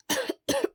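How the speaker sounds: harsh dry cough; sick boy coughing dryly. Acted. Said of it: "He coughed dryly".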